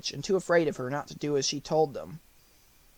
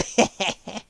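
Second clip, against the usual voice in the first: short wicked witch cackle; 3 semitones higher than usual. A narrator's reading. Witch gloating over Dorothy, a quick cackle. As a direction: cackle under your breath. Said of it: "the Wicked Witch laughed to herself".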